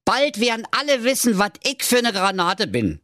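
high, cocky boast of a tiny hero; high boastful storybook hero voice; proud little web designer. Acted. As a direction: crow it out like a puffed-up little tailor. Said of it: "rief er".

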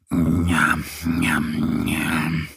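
low, slow munching njam njam; smacking lips. A narrator's reading. Little bear smacking over strawberries, lips smacking. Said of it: "schmatzt Mo".

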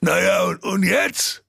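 high ogre voice, helpless question; lightly baffled ogre; at a loss. Acted. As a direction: ask what now, stumped. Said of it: "Da fragte der Karl-Heinz Menschenfresser ratlos".

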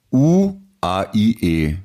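U A I E drawn very slowly, just the vowels; vowels only. Shown as a demonstration. Posing a vowel-only riddle to his co-host, very slow vowels. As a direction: say U A I E, slow and spaced. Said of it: "Heißt Futkanister".

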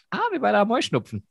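high, surprised exclamation; surprised realization. Retold or imitated. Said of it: "Und dann haben wir beide festgestellt".

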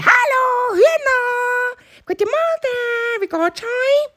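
very high, bright greeting; chirpy bunny. Acted. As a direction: sing out hello to the hens like a little hare.

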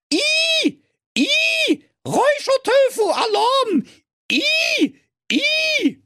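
very high shrill beeping alarm; smoke alarm voice. Acted. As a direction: squeal like a smoke detector. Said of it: "Und der Rauchmelder sprach". (piepsende Rauchmelder-Stimme)

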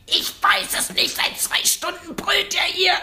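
whispered, slow and helpless; desperate whisper. Retold or imitated. Husband whispers to his wife that the baby has screamed for hours. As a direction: breathe it out like a worn-out father.

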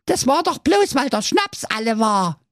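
sehr hohes, kleinlautes Zicklein-Ja; hoch und verlegen piepsen; zerknirschtes Geißlein. Acted. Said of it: "sprach das kleinste Geißlein zerknirscht". (kleinstes Geißlein gibt zerknirscht zu)

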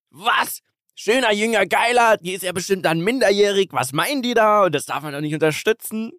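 high, shrill mock-outraged voice; outraged prude. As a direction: play a scandalised moral guardian. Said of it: "Die nächsten kommen aber und sagen".

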